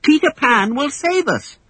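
Wendy defying Hook; defiant. Acted. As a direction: stand up to him. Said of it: "Wendy replied defiantly".

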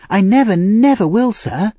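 put-on high-pitched boy promising fervently; earnest Oliver. A narrator's reading. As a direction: promise eagerly in a high child's voice.

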